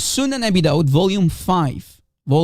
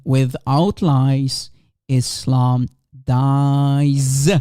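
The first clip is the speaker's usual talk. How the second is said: slow slogan; 6 semitones lower, much slower than usual. Real, not acted. Slogan delivered slowly.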